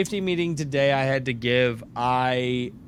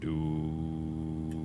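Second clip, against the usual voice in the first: put-on drunk voice; 8 semitones lower, 8 dB quieter than usual. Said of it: "is that like drunk?".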